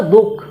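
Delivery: दुख said as dukh with the visarga folded into the kh; half visarga. Shown as a demonstration. Teacher shows students the half visarga in दुख. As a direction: let the visarga in दुख melt into the kh. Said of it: "विसर्ग का उच्चारण ... आधा कर रहे हैं".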